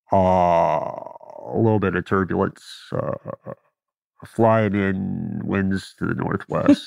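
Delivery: calm cockpit announcement, low and easy; pilot voice. Retold or imitated. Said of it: "everybody knows the stereotypical pilot voice".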